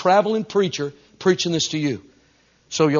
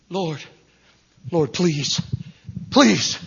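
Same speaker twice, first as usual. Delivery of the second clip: acted plea, breaking off between words; begging; 4 semitones higher, much slower than usual. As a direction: plead haltingly, rising on the last please. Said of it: "they start begging Him".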